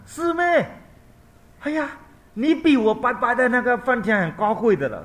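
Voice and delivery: very high, loud disbelieving cry; mother learning her son is above Brahma; astonished mother. Acted. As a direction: gasp in awe.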